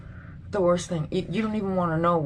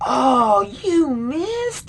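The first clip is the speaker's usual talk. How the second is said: gloating mock; 8 semitones higher, much slower than usual. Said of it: "record themselves live on social media and say".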